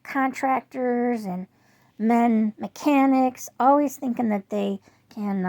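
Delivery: loud, slow, fed-up rant; fed-up complaint to husband; retold anger. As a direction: hammer each word, loud and slow. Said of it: "I said".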